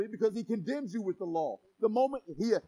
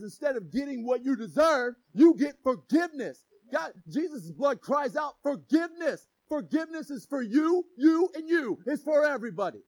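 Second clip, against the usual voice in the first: louder fervent proclamation; preaching shout; 6 dB louder than usual. Real, not acted. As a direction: cry out forgiveness to the crowd. Preaching forgiveness at full force.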